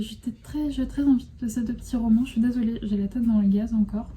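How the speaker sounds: ordinary talk, nothing marked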